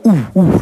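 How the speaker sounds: high, hooted "ooh, ooh"; short high echo hoots; reverberating wall sound. Retold or imitated. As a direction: imitate a ringing frequency with high oohs. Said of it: "reverberating at a kind of a".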